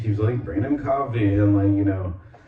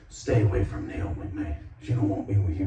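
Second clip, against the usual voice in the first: raspy voice; 2 semitones lower, 5 dB quieter than usual. Retold or imitated. Retelling a man warning off the ex-boyfriend, mock raspy. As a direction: lightly put on a raspy tough-guy voice. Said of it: "raspy, like, the D O C type voice".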